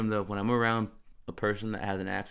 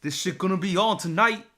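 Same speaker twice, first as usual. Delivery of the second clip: high, loud put-on New York voice; laughing put-on New York accent; mock New York slang; 8 semitones higher, 6 dB louder than usual. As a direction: ham up the New York street talk.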